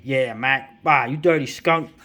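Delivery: hammed-up two-fisted hero voice; tough-guy retort. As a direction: spit it out like a pulp hero insulting the villain. Acted comic-book hero quote.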